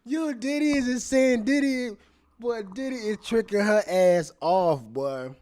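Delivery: high, loud, laughing disbelief; real excited shout of amazement; amazed outburst. Real, not acted. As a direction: cry out half laughing.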